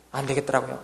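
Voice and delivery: ordinary delivery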